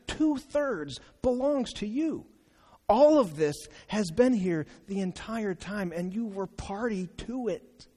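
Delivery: rising earnestly, pleading; father pleading with his angry older son, tender. Acted. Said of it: "shamed responds".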